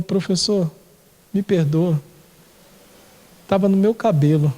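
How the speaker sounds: a hint of shame in a mumbled sorry; embarrassed apology. Preacher voicing the ashamed student apologizing to teacher, mildly sheepish. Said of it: "toda envergonhada".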